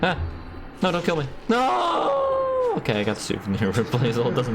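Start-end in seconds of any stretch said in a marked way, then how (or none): 1.5-2.7 long high "noooo"; drawn-out, high-pitched wail of defeat; mock scream. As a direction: cry out a playful, stretched no. Playing game, mock death cry.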